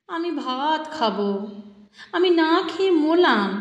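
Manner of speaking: put-on high, loud tearful whine of an old man; wailing old grandfather. Grandfather wailing to his son that nobody feeds him, high and loud. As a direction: sob out the complaint like a hungry child.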